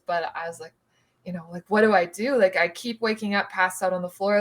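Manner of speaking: ordinary talk, nothing marked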